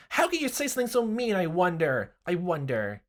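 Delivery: a shrill wounded question; wounded Rena. Acted. Rena hurt by his words, high and loud.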